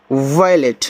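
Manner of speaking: वायलेट drawn out slowly, every sound clear; slow model word. Shown as a demonstration. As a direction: spell out वायलेट with your voice for the class. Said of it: "ऐसे". (धीरे धीरे वायलेट बोलकर दिखाना)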